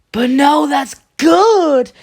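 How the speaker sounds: loud, high-pitched mock French accent; Frenchman impression. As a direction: shout a protest as a hammed-up Frenchman. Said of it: "Sorry, that was my Frenchman, um, impression".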